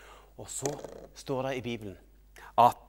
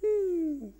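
high, falling "hmm"; sad whimper; 3 dB louder than usual. Acted. Showing children the sad figure, high mock whimper. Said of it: "han er lei seg".

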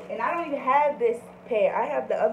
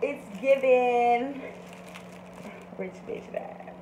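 slow, sassy brag; sassy slow drawl; 4 semitones higher, much slower than usual. Real, not acted. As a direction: drag it out, showing off. Showing off the purse.

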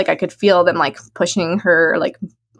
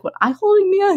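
confused question; 12 semitones higher than usual. Retold or imitated. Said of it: "I was like".

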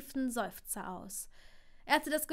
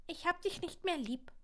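put-on offended child; 6 semitones higher than usual. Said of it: "murmelte Scorpius beleidigt".

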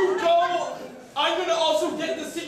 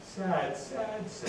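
hushed, slow murmur of sad; whisper in ear; 8 semitones lower, 10 dB quieter, much slower than usual. Acted. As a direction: breathe it into his ear. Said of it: "sad secret to whisper into your ear".

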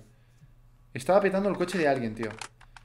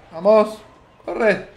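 urgent shout; 5 semitones higher, 8 dB louder, much slower than usual. Real, not acted. Urging himself to run in game.

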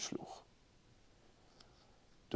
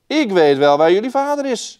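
cloud man; 8 semitones higher, 8 dB louder than usual. A narrator's reading. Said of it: "zei het wolkenmannetje".

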